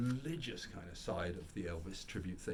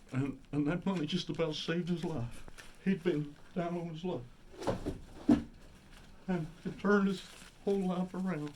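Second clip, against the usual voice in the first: high, louder Southern narrator impression; Hank Hill; 7 semitones higher, 4 dB louder than usual. Narrator tells how a tip saved him, drifting into Hank Hill. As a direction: push the tribute voice up into a cartoon Texan. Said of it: "I know I'm becoming Hank Hill now".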